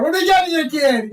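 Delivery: high, loud, hearty bartender bit; bartender greeting a regular. Acted. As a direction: call out for the order.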